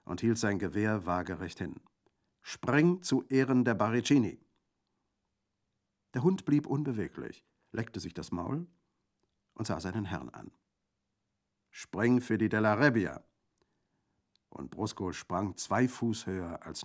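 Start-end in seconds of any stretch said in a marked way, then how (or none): 2.4-4.4 loud, high order to jump; dog command. A narrator's reading. Bandit making Brusco jump, loud command. 11.7-13.2 loud, raised order to jump; dog command. A narrator's reading. Bandit making Brusco jump, loud command.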